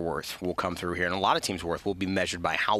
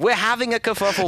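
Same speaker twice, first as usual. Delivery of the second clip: much higher, louder put-on British accent; bad British accent; 12 semitones higher, 6 dB louder than usual. Shown as a demonstration. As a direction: ham up a British bloke. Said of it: "really bad English accent".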